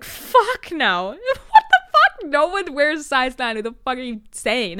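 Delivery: high, loud scoffing clerk who laughs at the customer; mocking store clerk. Store clerk mocking a request for a size nine ring, loud and scornful. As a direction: scoff at the question, laughing and outraged. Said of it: "They're like".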